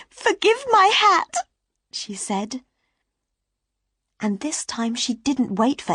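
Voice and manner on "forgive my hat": put-on high, loud, tearful plea like a little girl. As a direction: blurt it out through sudden tears, high and loud. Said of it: "She burst into tears like a little girl".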